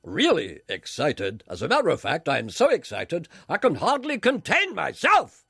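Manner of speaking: slow, drawn-out announcer voice; hammed-up announcer excitement, swooping on key words; Peter Dixon. Spoof announcer telling an interviewer he's excited, in a slow booming announcer voice. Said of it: "Take this encounter with X Factor announcer Peter Dixon".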